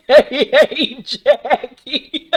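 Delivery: high laughing words. Real, not acted. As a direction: giggle through the name. Laughing at nickname.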